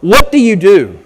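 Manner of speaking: loud, high, ringing preacher's voice; fired-up Sunday sermon cadence; preaching at full force. Acted. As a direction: preach it out to the whole congregation.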